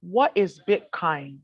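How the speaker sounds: very slow, drawn-out, unhurried questions; slow calm name reading. Retold or imitated. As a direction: read each name out slowly, as if weighing it. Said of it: "That's how they talk".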